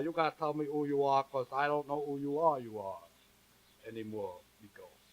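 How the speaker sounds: put-on comic French storyteller accent; broken English in a heavy French accent. French chanteur introducing a song to the audience, thick French accent.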